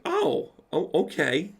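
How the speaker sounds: high startled yelp of surprise. Retold or imitated. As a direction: jump up in pitch, caught off guard. Shocked reaction to Linda.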